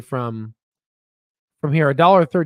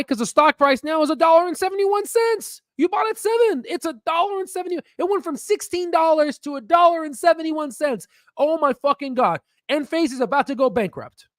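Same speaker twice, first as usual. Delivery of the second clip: high-pitched mock panic; 12 semitones higher than usual. Investor shrieking at a crashing stock, high mock panic. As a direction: pitch shoots way up in mock horror.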